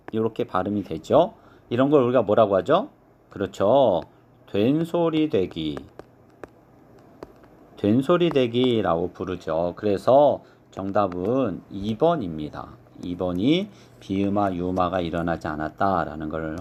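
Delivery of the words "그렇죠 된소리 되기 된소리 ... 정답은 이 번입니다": normal talking voice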